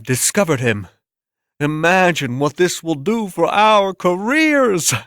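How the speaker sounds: high, breathless critic gushing over the work; awed art critic. Acted. Critics scheming over discovery.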